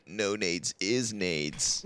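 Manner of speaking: slow, drawn-out low voice; Maui Snake impression. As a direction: drawl it slowly like a laid-back guy. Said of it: "That's my Maui Snake impression".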